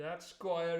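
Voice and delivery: plain reading voice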